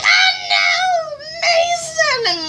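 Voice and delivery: high-pitched theatrical wail of dismay; mock wail. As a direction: cry out in hammed-up grief, very high. Mock wail over eaten villager.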